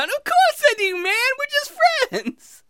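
high, squeaky reassurance; friendly inside elf. Acted. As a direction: reassure in a chummy little elf voice. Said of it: "You know what, Friendly?".

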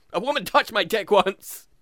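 ordinary talk, nothing marked

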